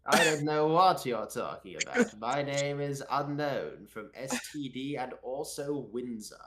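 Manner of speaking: hammed-up posh English, slowing grandly; Unknown. Pretend player defending his identity to the host, overdone posh English. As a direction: insist on it in a mock-aristocratic English accent. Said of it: "He does not sound like that".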